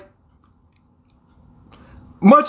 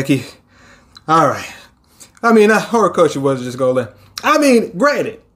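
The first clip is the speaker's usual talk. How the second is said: ordinary talk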